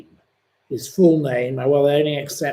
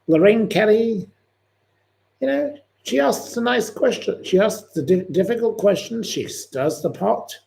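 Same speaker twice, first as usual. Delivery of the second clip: put-on weak Scottish accent, pitched higher; a clumsy try at a Scottish accent; admiring Lorraine Kelly in a poor Scottish accent; bad Scottish accent; 6 semitones higher, 3 dB louder than usual. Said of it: "Sorry, I'm not very good at doing the Scottish accent".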